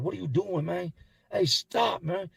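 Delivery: high, urgent retold protest; imitated alarmed protest. Young inmate to his attacker, imitated alarmed protest. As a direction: act out a victim pleading to stop, pitch jumping up.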